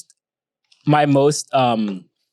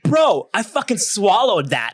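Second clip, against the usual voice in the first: slow, higher-pitched retold dismay; mock-disgusted protest; 6 semitones higher, slower than usual. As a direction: drag out the appalled complaint with amusement. Acts out disgusted protest to friend.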